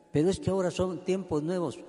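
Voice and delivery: a hint of a skeptic's protest; mock objection. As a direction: toss out the excuse quickly. Voicing a modern objection to God's law.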